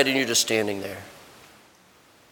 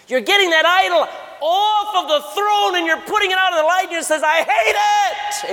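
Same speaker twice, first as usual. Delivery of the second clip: the idol screams as it's dragged out; idol shrieking; 12 semitones higher than usual. Acted. Preacher voices the exposed idol screaming in hate.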